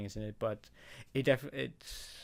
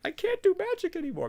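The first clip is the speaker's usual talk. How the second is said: high, loud mock panic as a unicorn; hammed-up distressed pony; panicked unicorn; 5 dB louder than usual. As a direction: squeak it out like a unicorn who lost her magic. Mock unicorn losing magic.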